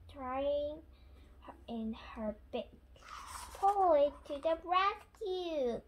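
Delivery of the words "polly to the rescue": higher, louder storybook call; rallying cry. A narrator's reading. Grandpa Pig cheers on the parrot, rallying cry. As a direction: call it out like a rescue announcement. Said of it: "cries Grandpa Pig".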